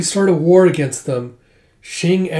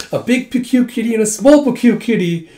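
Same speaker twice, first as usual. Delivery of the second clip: high, loud, laughing through the words; laughing quote; 7 semitones higher, 4 dB louder than usual. Retold or imitated. Amused at the cat-masked boy, laughing high. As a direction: giggle out the kitty names. Jeha laughing at the kitty names.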